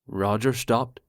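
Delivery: ordinary delivery, nothing marked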